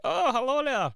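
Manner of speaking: very high, loud cartoonish Cumbernauld greeting; mock Cumbernauld accent. Everybody in Cumbernauld greeting him, high loud mock accent. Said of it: "Everybody was like".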